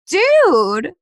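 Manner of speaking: an incredulous, stretched "dude"; exasperated. Retold or imitated. Exasperated reply acted in story.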